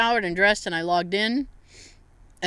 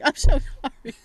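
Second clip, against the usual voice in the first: higher-pitched imitation of tearful apology; mock crying; 5 semitones higher, 4 dB louder than usual. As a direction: pretend to sob out an apology. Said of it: "she was, like, crying".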